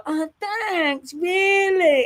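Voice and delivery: high, breathy mock-cute gushing; fake sweet girly voice. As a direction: gush it like a fake-sweet girl. Mocking Lucinda's fake sweetness.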